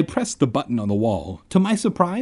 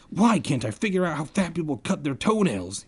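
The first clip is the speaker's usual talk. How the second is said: higher, strained mock yell without volume; 4 semitones higher, 3 dB quieter than usual. Reader improvising a character's frustration, mock yell. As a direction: fake a yell at modest volume. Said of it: "he yelled".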